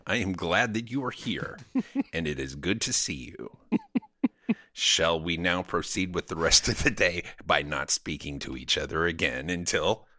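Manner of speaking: low, stiff, formal android; robot posing as human. Pretend. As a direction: recite each word with careful politeness. Continues robot small-talk bit.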